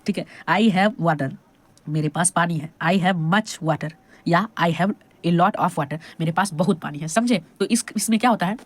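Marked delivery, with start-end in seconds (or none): 2.8-6.0 slow dictation. Shown as a demonstration. Teacher dictating English example.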